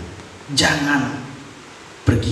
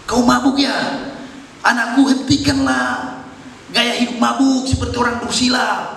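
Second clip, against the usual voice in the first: a high, louder rebuke; scolding priest; 12 semitones higher, 3 dB louder than usual. Acted. Priest Eli scolding Hana, thinking she is drunk, reproving. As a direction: chide her like a stern old priest. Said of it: "Imam Eli mengatakan".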